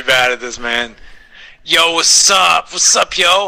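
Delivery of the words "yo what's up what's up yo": higher, louder slow hype-man opener; rapper intro. Pretend. Starting a freestyle on request, rapper hype. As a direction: open like a rapper on the mic. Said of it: "do you wanna spit some rhymes".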